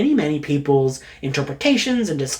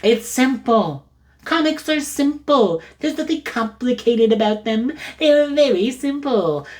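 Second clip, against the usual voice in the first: mock insistence; 6 semitones higher than usual. Fan defending convoluted comic lore, mock insistence. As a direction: insist in a pitched-up, comically strained voice.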